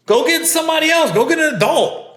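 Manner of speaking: high-pitched, insistent plea; exasperated urging. Real, not acted. Urges what should have been done.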